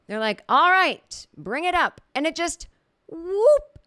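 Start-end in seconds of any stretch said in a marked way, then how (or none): 0.5-2.0 loud, high mock host's command; calling out. Acts out host calling for reveal. 3.1-3.8 high, loud rising whoop; imitated whoop.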